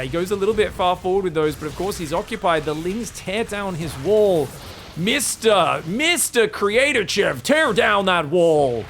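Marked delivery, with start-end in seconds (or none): 5.0-8.8 slow, loud grand oration; mock presidential speech. Serral taunting Creator, a hammed-up slow speech. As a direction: proclaim it like a famous speech. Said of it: "That's what Serral's saying right now".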